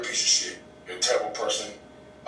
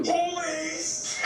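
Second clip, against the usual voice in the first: mock call out.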